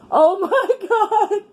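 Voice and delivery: high, slow and laughing through it; can't get the words out for laughing. Real, not acted. Retelling husband's question to viewers, laughing hard.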